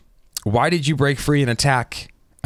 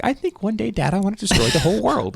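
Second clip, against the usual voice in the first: put-on little boy; 10 semitones higher than usual. Little boy telling his dad, high mock-childish voice. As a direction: pipe up like a small child. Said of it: "Aaron was a little boy".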